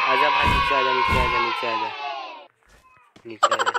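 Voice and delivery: loud shout to a teammate below; urgent calling across the map. Real, not acted. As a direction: yell for him to come down.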